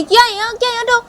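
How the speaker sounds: high, loud warning off; high-pitched alarmed outburst; agitated rejection. Acted. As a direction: shout her away in a high voice. Shanaya pushes Tisha away.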